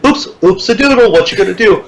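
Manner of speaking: high, sing-song oops, no big deal; mock carefree. Clerk brushing off stranded honeymooners, sing-song mock carefree. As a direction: toss it off playfully.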